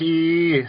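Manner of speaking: high, sustained "ee"; echoey held vowel. Shown as a demonstration. Showing cohost the old anyway, high echoing vowel. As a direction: stretch the vowel high like an echo. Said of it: "like, echo on it".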